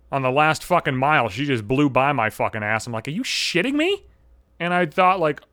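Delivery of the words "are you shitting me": high-pitched incredulous question; can't believe she's passing him; mock disbelief. Runner being overtaken by a stroller mom, high incredulous cry.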